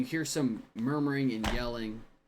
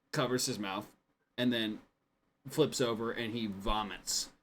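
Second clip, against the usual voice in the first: ordinary talk, nothing marked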